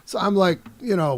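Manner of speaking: normal talking voice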